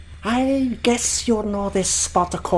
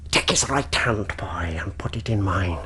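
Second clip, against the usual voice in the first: low, hushed whisper; menacing whispered order; sinister whisper; 5 dB quieter than usual. Acted.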